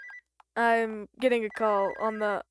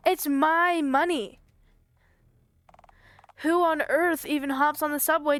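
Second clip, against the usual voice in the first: indignant protest; 3 semitones higher than usual. Acted. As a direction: draw it out like an outraged customer. Caller outraged at refusal.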